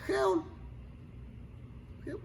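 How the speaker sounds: limp, soft mock call; feeble call. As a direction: barely raise the voice. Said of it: "Que no salga un leve". (llamado leve y débil)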